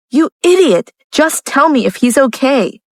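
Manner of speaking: high-pitched angry scolding. A narrator's reading. Lucia loses patience with Jeff on the phone, scolding. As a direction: scold sharply, pitch jumping up. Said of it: "Lucia scolded".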